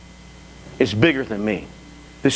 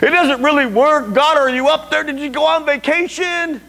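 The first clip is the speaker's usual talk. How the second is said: loud, high, hammed-up griping at God; mock complaining; 12 semitones higher, 4 dB louder than usual. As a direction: protest indignantly to heaven, playing it up. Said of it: "I just start complaining".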